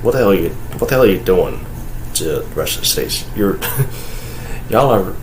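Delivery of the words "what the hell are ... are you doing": usual speaking voice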